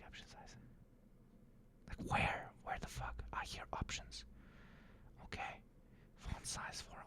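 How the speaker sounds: hushed whisper while fiddling with settings. Real, not acted. Streamer whispering to himself while fixing captions, hushed. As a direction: whisper under your breath, mostly to yourself. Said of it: "I'm so sorry".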